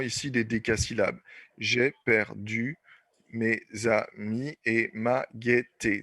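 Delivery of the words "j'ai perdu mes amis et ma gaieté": slow syllable count. Shown as a demonstration. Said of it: "Dix. Décasyllabes".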